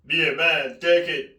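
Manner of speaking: usual speaking voice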